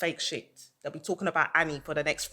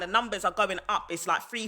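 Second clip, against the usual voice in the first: ordinary talk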